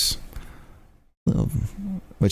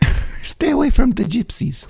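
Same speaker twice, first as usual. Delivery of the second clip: put-on much higher, slow old Italian granny; Italian grandma accent; 12 semitones higher, 3 dB louder than usual. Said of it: "I just need an Italian accent".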